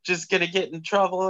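whiny scolding. Retold or imitated. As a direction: mimic a nagging passenger. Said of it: "she's like".

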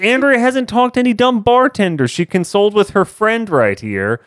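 high, laughing mock outrage at her cohost; shrill-free, teasing fake fury with laughter; mock exasperation. Real, not acted. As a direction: playfully scold him for spoiling it. Scolding cohost for jumping ahead.

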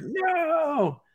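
way up high, squeaked mock no; squeaky high no.

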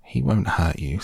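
usual speaking voice

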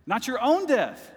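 high, loud, slow cry of Not your own death; rising preacher shout; preaching shout. Real, not acted. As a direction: build to a shouted peak. Preaching climax.

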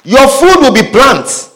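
emphatic scripture preaching. Acted. Preacher reads God's judgment to the man, emphatic preaching. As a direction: declaim the verse as a sentence passed.

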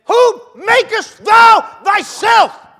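put-on old-fashioned words shouted high and slow in scorn; indignant Pharisee. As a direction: hurl the challenge at Jesus, drawn out and outraged. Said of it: "Pharisee said to Jesus".

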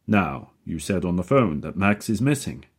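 loud, hearty booming question. A narrator's reading. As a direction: project like a showman. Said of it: "deep, booming voice".

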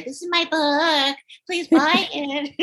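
high, drawn-out mock sales plea; cutesy young author. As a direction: mimic a sugary young author plugging a book. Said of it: "smile pretty and say".

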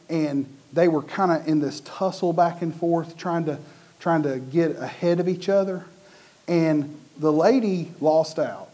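ordinary talk, nothing marked

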